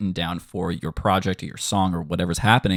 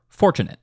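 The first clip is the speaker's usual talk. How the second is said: "Fortunate" with FOR punched higher; accent on first syllable; 7 semitones higher, 3 dB louder than usual. Shown as a demonstration. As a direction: hit FOR in "Fortunate". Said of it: "biggest accent for that word".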